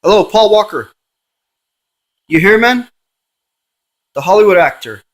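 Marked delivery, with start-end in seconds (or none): 0.0-2.9 louder, higher call into the dark; raised voice, hoping a spirit answers. Real, not acted. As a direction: shout out to an unseen ghost. Calling the ghost by name.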